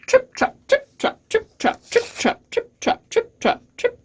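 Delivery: crisp, staccato, barely voiced trip-trop; light hoof steps. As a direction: tap out the words like tiny hooves. Little goat's hooves on the bridge.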